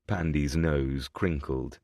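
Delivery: ordinary delivery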